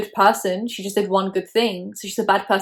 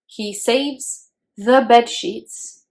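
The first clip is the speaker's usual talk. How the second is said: very slow, louder, word by word; drawn-out disbelief; 3 semitones higher, 5 dB louder, much slower than usual. Real, not acted. Vlogger revealing the shocking book detail, drawn-out disbelief. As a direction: spell out the outrage slowly and firmly.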